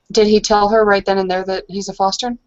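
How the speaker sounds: usual speaking voice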